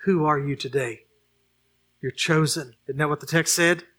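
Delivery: normal talking voice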